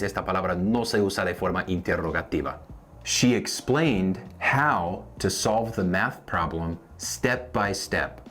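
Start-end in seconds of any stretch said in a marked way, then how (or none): 3.0-8.2 slow, word by word; slow teaching read. Shown as a demonstration. Teacher to Spanish learners, slow model sentence. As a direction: lay each word out slowly. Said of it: "dos ejemplos más".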